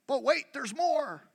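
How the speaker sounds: high, eager salesman pitch; infomercial pitch. Pretend. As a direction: sell it like a TV ad. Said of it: "I would sound like an infomercial".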